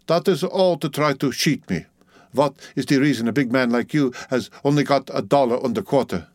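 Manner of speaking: put-on Dutch-accented suspicion; distrustful Dutch-accented woman; Dutch midwife, suspicious. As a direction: accuse him as a Dutch midwife.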